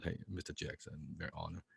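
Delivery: soft, low, modest retold greeting; polite deference. Young fan greeting his idol over lunch, quiet polite deference. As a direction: drop right down, humbly respectful. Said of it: "'cause I wanna be polite".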